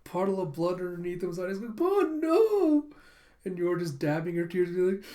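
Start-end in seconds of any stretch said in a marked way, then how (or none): 1.8-2.9 high, loud mock wail; drawn-out, hammed-up cry of loss; anguished cry. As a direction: wail in melodramatic grief. Imagined cry over dead dog.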